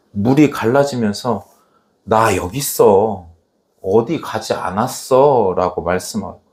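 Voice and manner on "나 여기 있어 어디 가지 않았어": low, slow, steady answer; calm reassurance. Retold or imitated. God answering Elisha as the water parts, calm reassurance. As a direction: reassure gently, pitched down and unhurried.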